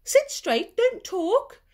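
high, loud stern commands; a strict teacher barking at the class. Acted. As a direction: snap orders at the class. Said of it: "telling the class".

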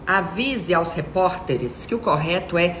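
normal talking voice

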